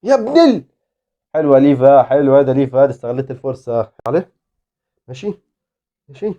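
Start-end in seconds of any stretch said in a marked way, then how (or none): none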